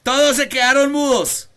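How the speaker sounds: preacher chiding his silent students; loud rebuke. Acted. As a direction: call out the silent room. Preacher scolding silent class.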